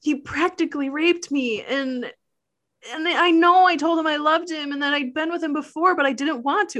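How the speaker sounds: put-on upset explaining voice; mock distraught plea. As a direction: play a pleading victim, hammed up. Acts out Melissa's plea to cops.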